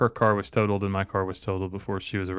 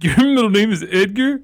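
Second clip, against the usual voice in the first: mock disbelief; 11 semitones higher, 7 dB louder than usual. People mocking his name.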